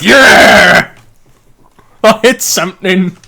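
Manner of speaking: loud, high triumphant yell; excited shout. Retold or imitated. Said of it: "and he's like".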